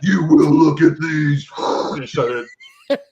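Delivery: hammed-up command to the guests in the toy room; mock commanding host. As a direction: order them like a stern jailer. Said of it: "Was like".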